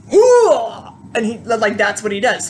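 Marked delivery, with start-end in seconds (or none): 0.1-0.6 high, very loud "hoo"; loud war whoop. Retold or imitated. Seminar guru fighting off the voice, loud war whoop. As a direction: whoop like a charging warrior.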